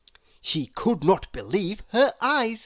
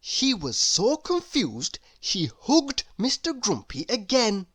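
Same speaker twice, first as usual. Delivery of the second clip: ordinary delivery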